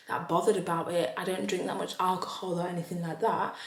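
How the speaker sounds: normal talking voice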